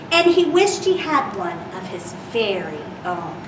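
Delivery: gentle storybook reading voice; reading to children. Acted. Reads picture book to children.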